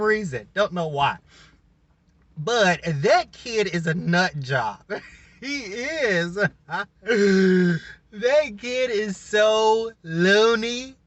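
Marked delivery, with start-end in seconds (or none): 4.9-11.0 laughing. Real, not acted. Laughing about wild cast member.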